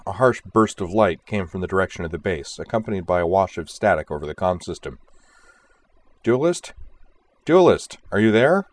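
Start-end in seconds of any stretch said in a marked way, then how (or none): 6.2-8.7 higher, urgent radio hail; calling out. A narrator's reading. Boomer hails her silent leader after a blast, calling out. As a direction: call into the static, louder on the second try. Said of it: "Boomer called".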